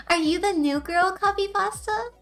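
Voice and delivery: usual speaking voice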